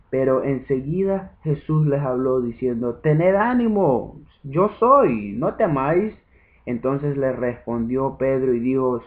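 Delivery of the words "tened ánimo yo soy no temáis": raised, higher voice, a little louder; encouraging call. A narrator's reading. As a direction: call out reassurance to frightened followers. Jesus calls to frightened disciples.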